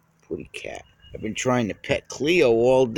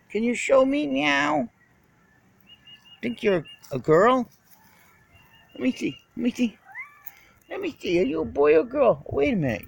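higher sing-song questions to the kitty; 10 semitones higher than usual. Real, not acted. Cooing at a cat.

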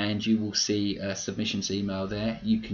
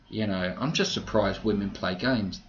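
ordinary talk, nothing marked

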